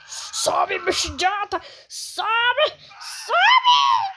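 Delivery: shouting high and loud at the screen; frantic yelling. Real, not acted. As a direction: yell at the character to run. Yelling at game character fleeing. (gritando desesperado)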